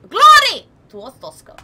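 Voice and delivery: sudden loud, high burst; zealous slogan. Pretend. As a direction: blast the first word like a patriot. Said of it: "I just want to read it like that".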